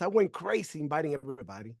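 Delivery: ordinary delivery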